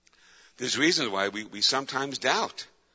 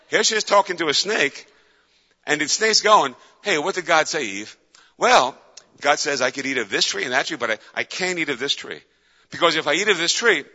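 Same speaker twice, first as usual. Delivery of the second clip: louder and higher storytelling; loud dramatic retelling; 4 semitones higher, 8 dB louder than usual. Acted. Preacher acting out Eve and the snake, loud. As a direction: belt out the garden scene. Eve dialogue, acted loudly.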